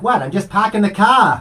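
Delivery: high, loud put-on East Coast accent; mock Boston accent. Teasing his cohost by imitating her East Coast accent. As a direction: ham it up like a Boston local. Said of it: "And you're like".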